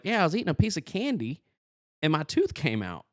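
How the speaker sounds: high, put-on boyish voice; Turkey Feather. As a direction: explain it like a cartoon kid. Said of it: "looking and sounding like Bobby Hill".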